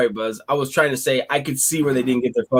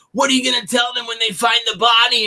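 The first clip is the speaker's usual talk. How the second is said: higher, shouted menace; mock threatening shout; 7 semitones higher than usual. Woman on her phone threatening the speaker, mock menacing shout. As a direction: throw her creepy threat back as a shout. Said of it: "she goes".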